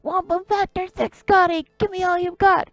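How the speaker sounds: high, loud urgent order; captain's shout. Acted. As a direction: demand everything the engines have, loudly.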